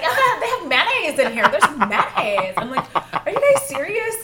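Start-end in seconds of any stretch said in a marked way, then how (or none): 0.0-2.6 loud, hammed-up tourist excitement; mock amazement. Classmates amazed by foreign condiments, mock excited. 3.0-4.2 high, loud, laughing disbelief; incredulous. Retold or imitated. Reacting to naive classmates, incredulous.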